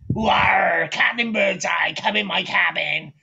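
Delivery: put-on slow, growled old sea-captain call; Captain Birdseye. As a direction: lure them in like a hammy sailor. Acts Captain Birdseye luring children.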